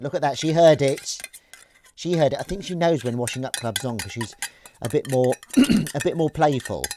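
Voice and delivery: talking to the kitten. Real, not acted.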